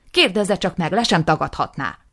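loud, furious outburst; angry accusation. Acted. Nisim accusing his brother, loud and furious. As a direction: spit out the accusation.

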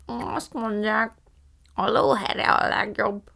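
slow, muffled talk through a bite; mouth full of toast. A narrator's reading. Fan néni chimes in through a bite of toast, mouth full. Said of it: "egy falat pirítóson keresztül".

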